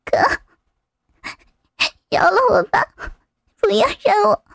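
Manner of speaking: breath-broken, halting plea; distressed character voice, long pauses; frightened pleading. Acted. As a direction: beg for mercy between gasps. Coerced character begging for mercy.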